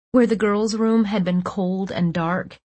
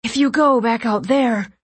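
higher-pitched anxious warning; anxious rambling; 4 semitones higher, 3 dB louder than usual. A narrator's reading. Nico warning them against leaving, mildly anxious. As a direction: warn with a touch of urgency. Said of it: "Nico rambled".

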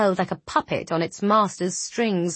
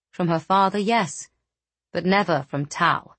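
ordinary delivery

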